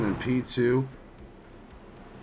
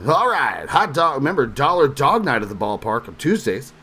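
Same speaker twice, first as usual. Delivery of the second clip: baseball announcer voice; 3 semitones higher, 5 dB louder than usual. Acted. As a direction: hype the dollar-dog deal like a stadium announcer. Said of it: "Yeah, I can't do that".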